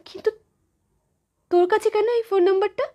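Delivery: high, fast, puzzled question; suddenly wonder, pitch leaping up; startled questioning. Acted. Mother startled child has the number.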